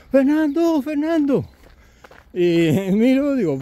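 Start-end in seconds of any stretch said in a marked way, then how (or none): 0.1-1.4 calling out. Retold or imitated. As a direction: shout the name again from a distance.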